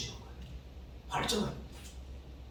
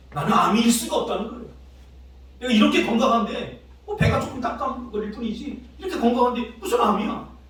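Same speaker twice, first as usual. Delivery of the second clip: imitating a patient's protest, higher-pitched; acted denial; 8 semitones higher, 4 dB louder than usual. Student's father rejecting his cancer diagnosis, acted denial. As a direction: insist in disbelief, voice raised in pitch. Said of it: "첫 단계는 부정하는 거예요".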